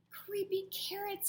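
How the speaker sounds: put-on scared child shouting; frightened shout. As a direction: shout it in fear. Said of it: "he shouted".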